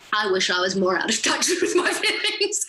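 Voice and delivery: laughing. Real, not acted. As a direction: giggle while confessing. Laughs through the line.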